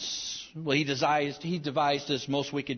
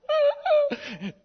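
high laugh; 4 dB louder than usual. Real, not acted. Laughing at own story.

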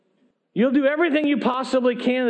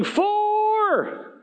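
Four! called out high like a golfer's warning; yelling Four; 10 semitones higher than usual. A narrator's reading. Preacher telling the church, golfer's warning shout. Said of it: "He is yelling".

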